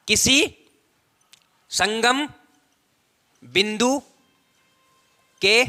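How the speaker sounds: slow word-by-word dictation, long pauses. Real, not acted. Teacher dictating the definition to students, slow measured dictation. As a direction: dictate it piece by piece for note-taking.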